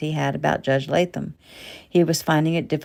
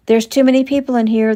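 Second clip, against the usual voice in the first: brisk nurse; 7 semitones higher, 8 dB louder than usual. A narrator's reading. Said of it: "the nurse said".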